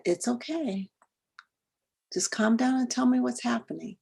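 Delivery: put-on calm a scared child over the phone; reassuring mother. Mother calming her lost son on the phone, softly soothing.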